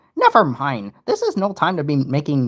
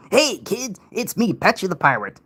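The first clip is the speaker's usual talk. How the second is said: Patchy the Pirate; 6 semitones higher than usual. Retold or imitated. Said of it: "Can you do an impression of Patchy and Potty?".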